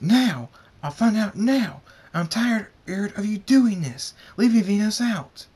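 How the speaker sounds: high, loud furious shouting; heated demand, forcefully stressed; angry outburst. A narrator's reading. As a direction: yell it at your father, fed up. Enraged son confronts father, eyes red.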